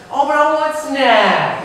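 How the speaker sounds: put-on slow, loud, whiny child voice; drawn-out childish whine for snack; whiny kid. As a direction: whine it out like a pouty toddler.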